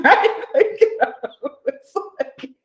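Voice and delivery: high words broken by laughter; laughing while talking. Real, not acted. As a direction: lose it laughing mid-sentence. Breaks up laughing after the quote.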